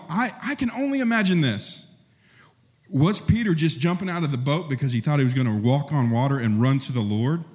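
ordinary delivery

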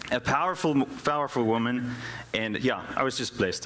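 ordinary talk, nothing marked

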